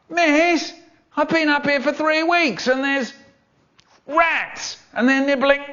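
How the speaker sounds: put-on very high child's wail; whining prisoner. Pupil strung up in school prison complaining to teacher, wailing.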